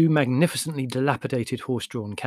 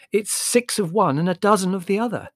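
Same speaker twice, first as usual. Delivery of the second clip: high, raised-voice clincher; triumphant; 8 semitones higher, 5 dB louder than usual. A narrator's reading. Margot finishes arguing with Larry, triumphant. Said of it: "said Margot triumphantly".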